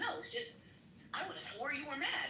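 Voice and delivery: high-pitched imitation of a tiptoeing sergeant; mock hedging. Sergeant explaining to worker who seemed mad, high mock hedging.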